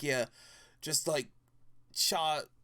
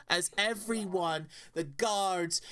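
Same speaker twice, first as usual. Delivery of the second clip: ordinary talk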